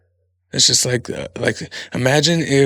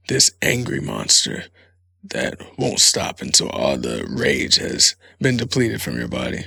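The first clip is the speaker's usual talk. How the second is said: slow, lower, ominous tale voice; spooky storyteller; 6 semitones lower than usual. Acted. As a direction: tell it like a dark legend.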